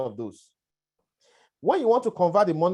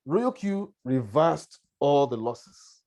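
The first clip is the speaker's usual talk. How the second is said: each word drawn out slowly for weight; slow emphatic repeat; much slower than usual. Shown as a demonstration. Presenter repeating his key claim to the webinar, slow and weighty. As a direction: let it land, word by word, slowly.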